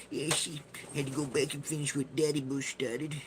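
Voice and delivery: a cowboy on cocaine with a broken nose; George W Bush goblin voice. Retold or imitated. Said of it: "I make him sound like a goblin".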